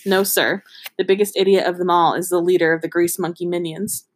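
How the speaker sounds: ordinary talk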